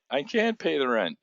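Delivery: high, pleading silent-film damsel; damsel in distress. Retold or imitated. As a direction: plead like a helpless heroine. The poor damsel who.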